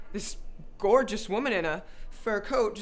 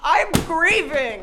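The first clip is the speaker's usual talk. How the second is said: melodramatic protest; 4 semitones higher, 8 dB louder, slower than usual. Acted. Owner refuses to pay, melodramatic.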